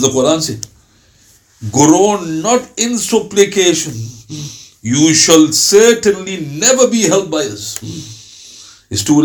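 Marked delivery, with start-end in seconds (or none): 1.7-7.8 slow, weighty scripture reading; solemn verse reading. Acted. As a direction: read the verse slowly, like holy text. Reciting verse translation aloud.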